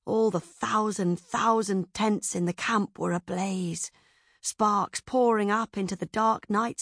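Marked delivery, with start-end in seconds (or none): none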